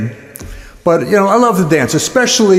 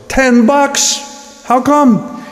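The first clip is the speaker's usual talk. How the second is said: high-pitched astonished question; mock-amazed yelp; incredulous; 10 semitones higher, much slower than usual. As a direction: leap up in pitch in disbelief. Shocked at the low price.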